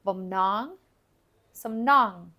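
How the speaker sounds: "Bum nong, some nong", voice lifting on each "nong"; accent on second syllable. Shown as a demonstration. Teacher demonstrates the accent, voice up on "nong" in "Bum nong, some nong". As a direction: raise each "nong" just a little to accent it. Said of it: "voice goes up just a little bit to make the accent".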